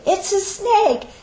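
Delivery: high frightened shout, laughing; panicked cry. Pretend. Teacher acting out panic at a snake, high mock shriek. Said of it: "So you say".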